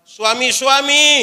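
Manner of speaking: loud, high, drawn-out call. Real, not acted. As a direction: call out to the whole congregation. Calling husbands in the congregation. (seruan memanggil jemaat, keras dan tinggi)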